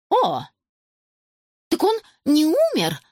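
high-pitched startled cry; shocked knitter's exclamation; exclaiming. A narrator's reading. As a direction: gasp it out in astonishment. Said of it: "воскликнула вязальщица".

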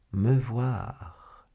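sly. A narrator's reading. Lord de Winter doubting Milady's visit, sly. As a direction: repeat her words with sly suspicion. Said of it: "dit sournoisement".

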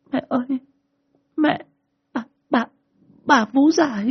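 put-on high child voice, a touch of whimpering; little girl stammering in fright, pitched very high. A narrator's reading. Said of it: "Tôi gào lên mếu máo".

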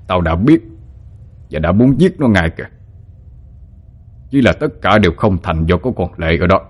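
lightly voiced scheming older man; gloating villain. A narrator's reading. Ông hai tells Hồng Ánh she was fooled, gloating menace.

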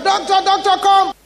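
high, loud call for the doctor; shouting. Retold or imitated. Healed patient calls the doctors in, shouting. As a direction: shout out urgently to summon help. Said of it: "So she shouted".

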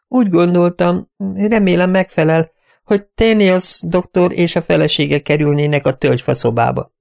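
high, hesitant, eager-to-please voice; timid companion voice. Acted. Timid companion explaining the rooms to her mistress, high and eager.

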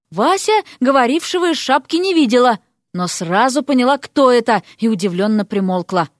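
usual speaking voice

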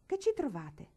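put-on boy alarmed at the aunt's verdict, crying out. A narrator's reading. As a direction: shout it out, alarmed. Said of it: "gridò Billy".

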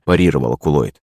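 ordinary delivery

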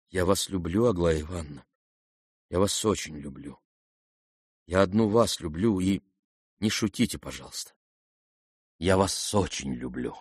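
low, soft, halting declaration; hushed love confession. A narrator's reading. Prince declares love to Aglaya, hushed and sincere. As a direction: confess it quietly, with long pauses.

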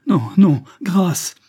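loud, high cries for mercy; desperate begging. A narrator's reading. Woman told to prepare to die, begging desperately. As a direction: beg in panic, voice thrown up.

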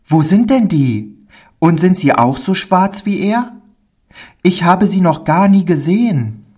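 put-on high, curious child voice; astonished little boy. Pauli asks the man about those children, amazed high child voice. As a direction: fire off wondering questions as a small boy.

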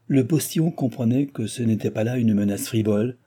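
ordinary delivery, nothing marked

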